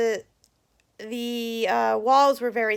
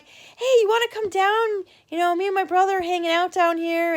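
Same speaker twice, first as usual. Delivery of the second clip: high, eager invitation; fake excitement; 7 semitones higher than usual. Prankster luring the girl downstairs, fake excitement. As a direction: imitate an excited kid inviting a friend, starting high. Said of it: "I'll be all excited and I'll say".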